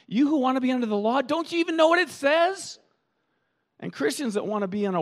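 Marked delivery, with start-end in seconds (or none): 0.1-2.8 high, fast, exasperated scolding; incredulous Paul. Retold or imitated. Paul rebukes those under the law, high and fast. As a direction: rush the rebuke in disbelief. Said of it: "Paul saying".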